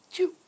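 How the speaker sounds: imitated dainty little choo; tiny mouse sneeze. Shown as a demonstration. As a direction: squeak out a very short fake sneeze. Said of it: "You like the little".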